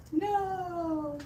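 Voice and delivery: high squeaky snail voice; voicing the snail. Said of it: "He's like".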